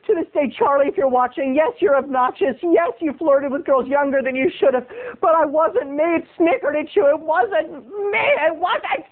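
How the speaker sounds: high, wailing protest of innocence; hammed-up indignant tirade. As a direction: rant at the star in melodramatic high pitch. Mock tirade addressed to the star.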